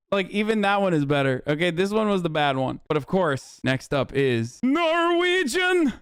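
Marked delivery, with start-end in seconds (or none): 4.6-5.9 high, drawn-out announcer call; showy announcement. Pretend. Host revealing the next accent, grand drawn-out call. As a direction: stretch the word out high and big.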